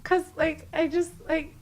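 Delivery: high-pitched tearful retelling; squeaky, overcome-with-tears voice; mock crying. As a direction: play it as choked up and weepy. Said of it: "I start crying again".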